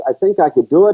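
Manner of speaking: pleading son. Pretend. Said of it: "let's pretend you're my dad".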